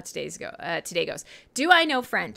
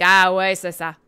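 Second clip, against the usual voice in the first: mock French reply, run together; slurred French reply; 8 dB louder than usual. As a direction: fake your way through in French. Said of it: "You just kinda draw it all together".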